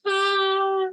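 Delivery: high, tender, welling up; an overwhelmed first touch of the baby; emotional. Retold or imitated. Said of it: "I started to get emotional".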